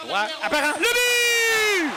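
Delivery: high shouted goal call; excited goal call. Real, not acted. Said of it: "On l'a entendu souvent celle-là".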